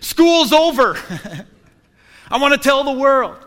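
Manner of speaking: high, laughing whoop of a student; excited student. Retold or imitated. Student celebrating end of school, excited laughing. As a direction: cheer like school just ended.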